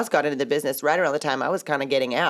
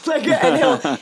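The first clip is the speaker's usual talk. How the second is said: high loud slow laugh; 11 semitones higher, 6 dB louder, much slower than usual. Real, not acted. Broker laughing while telling about her agent. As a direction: laugh out the words.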